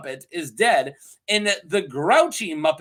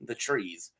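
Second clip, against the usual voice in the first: ordinary talk, nothing marked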